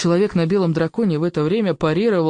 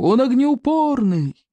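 shouting from above; 7 semitones higher, slower than usual. A narrator's reading.

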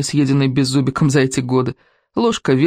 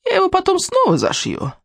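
witch voice. Acted.

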